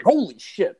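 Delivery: high startled exclamation. Retold or imitated. Man retells finding a raccoon in the trash, startled. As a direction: blurt it in retold shock.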